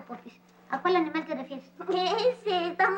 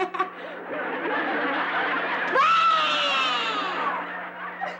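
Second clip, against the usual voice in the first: long high crying wail; childish wail, drawn out; 7 semitones higher than usual. Acted. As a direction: burst out bawling like an offended little girl. Girl teased, threatens to tell papá.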